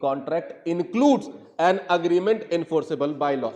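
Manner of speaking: slow read, "includes" leaned on; stress on includes. Shown as a demonstration. Teacher contrasting two readings for students, slow with "includes" stressed. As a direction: read it slowly, pressing on "includes". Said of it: "अगर मैं पढ़ता हूं इसको ऐसे".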